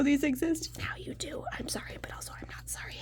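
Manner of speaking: soft, quick teasing whisper; playful whisper. Real, not acted. Teasing viewers at the end of the video, whispering. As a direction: whisper a cheeky not-sorry.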